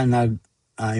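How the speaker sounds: ordinary delivery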